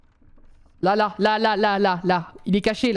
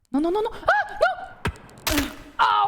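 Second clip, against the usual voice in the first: panicked; 11 semitones higher, much slower than usual. Real, not acted. Horror game jump scare panic.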